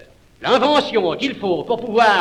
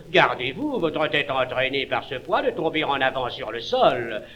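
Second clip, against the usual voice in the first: softer, solicitous warning; considerate; 6 semitones lower, 5 dB quieter than usual. Acted. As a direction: warn him gently. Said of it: "Prévenant".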